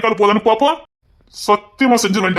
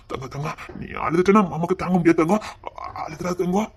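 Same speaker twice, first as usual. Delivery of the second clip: soft soothing; 4 semitones lower, 7 dB quieter than usual. Acted. Soothing crying daughter.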